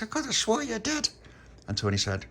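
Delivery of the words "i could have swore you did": incredulous. Acted. Child disputes friend's denial.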